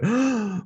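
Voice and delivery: a sharp, high imitated gasp of amazement; quick astonished intake of breath; audience gasp. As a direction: gasp like a wowed crowd. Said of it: "and they would go".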